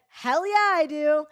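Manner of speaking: high, loud defiant yell; defiant shout. Retold or imitated. Retelling her reply to the Uber guy, a loud defiant yell. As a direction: throw it back at him, proud and loud. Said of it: "I said".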